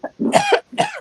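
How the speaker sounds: harsh choking coughs; coughing fit. Real, not acted. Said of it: "I was choking".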